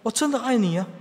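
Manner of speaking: high, pleading husband's protest; mock tearful. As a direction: act hurt, insisting on your love. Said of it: "所以他哭就说". (带哭腔地申辩)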